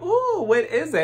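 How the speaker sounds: high, bright squeal of fake delight; mock excitement. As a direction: gush over the gift. Excited mock reaction to gifts.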